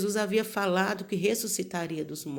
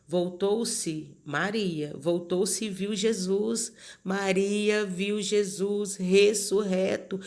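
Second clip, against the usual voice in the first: slow solemn emphasis; much slower than usual. Acted. Climax of resurrection story.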